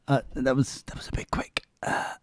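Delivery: hushed whisper, voice dropped away; conspiratorial whisper to the listener; whispered aside. Real, not acted.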